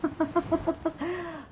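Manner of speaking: quick embarrassed laugh; short laugh at himself. Real, not acted. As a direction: laugh off the slip. Laughs at own slip.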